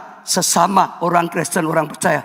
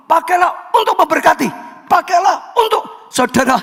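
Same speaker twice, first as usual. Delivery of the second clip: fervent preaching; 9 semitones higher than usual. Real, not acted. Pastor urging the church to speak kindly, rising fervent preaching. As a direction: hammer each purpose of speech.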